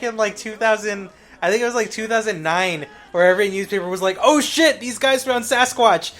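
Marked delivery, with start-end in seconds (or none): 4.2-6.1 loud, raised mock-headline excitement; excited announcement. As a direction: blurt out the big scoop, then stretch it. Acts out newspapers announcing a find.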